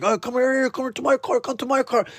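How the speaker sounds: sleazy guys luring. Retold or imitated. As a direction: coax the girls to the car.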